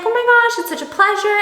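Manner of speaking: high, bright-eyed gush of delight; over-eager welcome to the new neighbor; gushing politeness. Retold or imitated. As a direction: sound thrilled to meet her. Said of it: "And I'm like".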